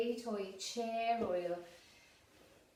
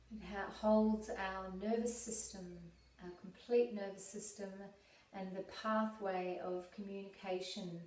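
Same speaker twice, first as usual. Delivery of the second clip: calm, unhurried meditation voice with long pauses; guided meditation; 4 dB quieter than usual. Real, not acted. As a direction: guide them gently inward, leaving space to breathe.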